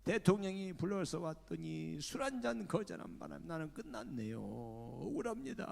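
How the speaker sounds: high, loud wailing cries to God; crying prayer. Retold or imitated. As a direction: sob and shout your injustice. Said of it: "하고 엉엉 울면서".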